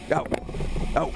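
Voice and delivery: usual speaking voice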